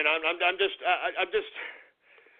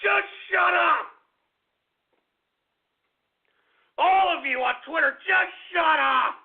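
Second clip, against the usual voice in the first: angry shouting; 4 semitones higher than usual. Real, not acted. Host silencing everyone on Twitter, angry shouting. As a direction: shout them down, stretching the command.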